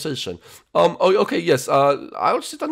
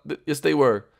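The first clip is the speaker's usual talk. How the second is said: ordinary talk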